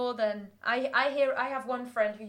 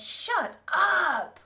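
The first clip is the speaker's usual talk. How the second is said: put-on higher, disbelieving teen "shut up"; American teenager; 7 semitones higher, 3 dB louder than usual. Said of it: "the American teenage".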